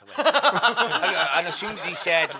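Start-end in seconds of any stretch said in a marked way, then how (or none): 0.2-1.3 loud, high burst of laughter. Real, not acted. Storyteller cracking up at his plane story, laughing. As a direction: crack up loudly.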